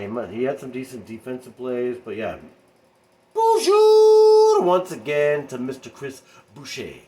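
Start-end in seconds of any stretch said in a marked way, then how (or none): 3.3-7.0 much higher, louder, slow hype announcer; announcer call. Pretend. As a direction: boom it out like an arena announcer. Hype intro for a player.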